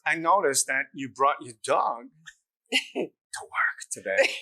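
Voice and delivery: slow impression sinking into a whisper; Christopher Walken. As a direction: draw it out, ending in eerie whispers. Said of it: "conversation with Christopher Walken".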